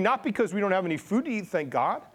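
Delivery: full-force sermon delivery; impassioned preaching. Acted. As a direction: preach it with mounting fervor.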